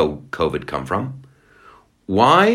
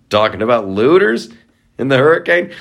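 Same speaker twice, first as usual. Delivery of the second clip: usual speaking voice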